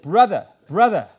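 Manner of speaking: loud, warm call of brother to brother. Retold or imitated. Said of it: "I won't do the accent".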